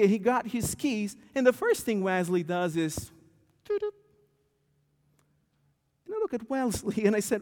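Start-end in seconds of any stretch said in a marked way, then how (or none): 3.6-3.9 a mock remote-lock beep, doo-doo; imitated car alarm chirp. Imitating the truck alarm being switched off. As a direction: chirp it like a key fob.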